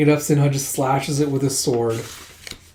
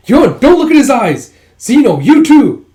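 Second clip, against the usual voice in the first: high, very loud cry of alarm; urgent warning shout; 11 semitones higher, 11 dB louder than usual. Retold or imitated. Warning friends away from Sinha's eyes, urgent high shout. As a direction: shout to protect your friends.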